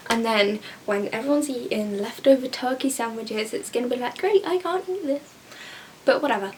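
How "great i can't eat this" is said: high, sarcastic mock complaint; mock dismay. Left out of the turkey sandwiches, mock dismay. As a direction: put on a high-pitched put-out voice.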